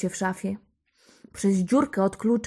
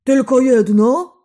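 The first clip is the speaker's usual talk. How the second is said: loud, slow roar of an enraged giant; furious roar; 4 semitones higher, 12 dB louder than usual. A narrator's reading. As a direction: roar it like an angry ogre. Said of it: "ryknął rozwścieczony olbrzym".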